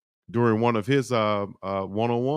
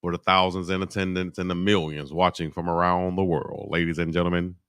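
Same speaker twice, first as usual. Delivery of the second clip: booming ring announcer intro; grand entrance announcement; 3 semitones lower than usual. Shown as a demonstration. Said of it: "if you need some voiceovers".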